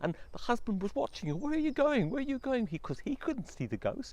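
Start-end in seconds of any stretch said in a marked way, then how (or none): 1.4-2.7 high, loud crying out; desperate call. Acted. Husband crying out as his wife is taken. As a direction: call after her.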